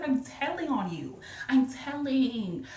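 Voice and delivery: high, drawn-out tattletale tease; playful retold threat to tell; mock tattling. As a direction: stretch it out like a tattling kid.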